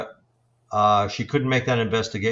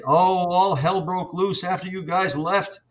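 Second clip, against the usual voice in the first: higher-pitched imitation of a client; raised-pitch mimicry of someone telling what happened; excited client; 7 semitones higher than usual. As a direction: act out a householder's alarmed report. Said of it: "persons would say".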